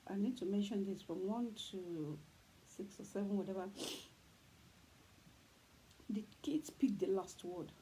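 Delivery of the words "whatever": ordinary delivery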